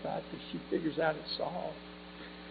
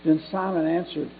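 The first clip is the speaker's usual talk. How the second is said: louder, emphatic pulpit reading; Scripture reading; 2 semitones higher, 10 dB louder than usual. Acted. Preacher reading Acts chapter eight to the congregation, emphatic Scripture reading. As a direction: read scripture out to the congregation, stressed words punched up.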